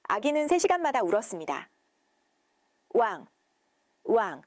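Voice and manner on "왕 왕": short mock newborn wail; lightly voiced newborn cry; imitated baby cry. A narrator's reading. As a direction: voice the baby crying in short bursts.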